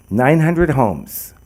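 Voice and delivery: ordinary talk, nothing marked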